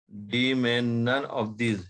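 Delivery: "none of these" drawn out word by word; slow dictation. Real, not acted. Teacher dictating option D to students, slow dictation. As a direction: say "none of these" slowly for note-takers. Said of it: "मैं बहुत धीरे धीरे बोल रहा हूँ".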